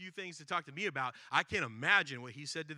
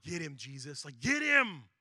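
egging on; 8 dB louder, slower than usual. Retold or imitated. Crowd urging Jesus on against Zacchaeus, building to a loud cheer. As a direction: urge him on like a fan at a fight. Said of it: "you're starting to go, like".

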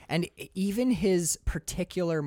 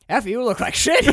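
loud mock Sam Spade; 8 semitones higher, 9 dB louder than usual. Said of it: "He's like".

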